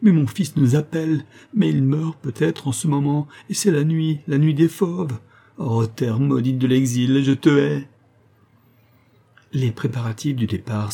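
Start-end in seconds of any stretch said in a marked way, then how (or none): 0.0-7.9 high, anguished outburst, building to a curse; desperate plea. A narrator's reading. Poor mother fearing her son is dying, desperate plea.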